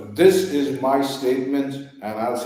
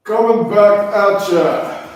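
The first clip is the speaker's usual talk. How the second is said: announcer shout; 7 semitones higher, 9 dB louder, slower than usual. Acted. Host returns to debate.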